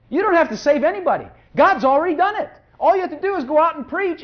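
preacher lifting the congregation; fervent preaching. Real, not acted. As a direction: build the fervour up. Preaching climax.

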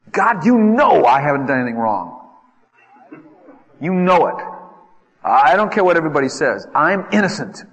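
loud, high protest of innocence; indignant protest. Acted. Preacher voicing Job, crying out to God in protest. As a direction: plead innocence to God, voice climbing.

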